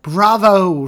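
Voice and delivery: loud, high cheer; ringing exclamation from an audience member; exclaimed praise. A narrator's reading. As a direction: belt out a long, delighted bravo. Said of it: "she exclaimed".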